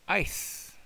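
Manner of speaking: "Ice" said high and airy; teacher introducing the word; model word. Shown as a demonstration. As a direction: present "Ice" for the class. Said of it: "the epsilon and the iota form a diphthong".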